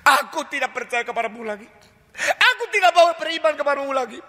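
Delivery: high, defiant refusal; a bitter believer giving up on God; rejecting God. Retold or imitated. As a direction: turn away from God in protest.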